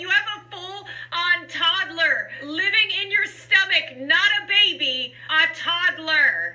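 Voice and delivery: high, hammed-up sob of a worried doctor; fake-cry the bad news to the patient; mock crying. Alarming news about toddler.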